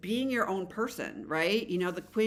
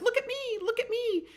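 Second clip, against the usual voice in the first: much higher, showy "look at me" call; playful Fool card. Acted. Reader voicing the Fool card to her viewers, playful high call. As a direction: chirp it like a card begging for attention. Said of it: "the Fool card's like".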